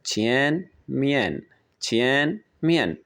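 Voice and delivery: छिएन rising, मियन falling, both drawn out; Chinese pronunciation demo. Shown as a demonstration. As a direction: model छिएन मियन slowly, syllable by syllable. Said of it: "इसे हम कहते हैं". (छिएन उठते सुर में, मियन गिरते सुर में)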